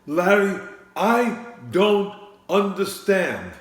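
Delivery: a cranky politician scolding the wine seller, loud and high; Bernie Sanders impression. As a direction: harangue him like a fed-up candidate at a debate. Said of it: "Larry David's impersonation of Bernie Sanders".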